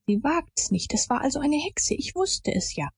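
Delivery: half to himself, confirming his suspicion; lightly voiced boy, sure of himself. A narrator's reading. Boy Tom at the sand pit, lightly voiced, knowing.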